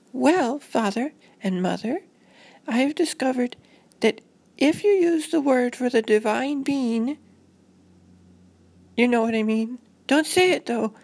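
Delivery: put-on high-pitched earnest child voice; high, solemn kid voice; anxious little girl. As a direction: play a worried girl lecturing her parents. Storyteller voices the little girl Robin.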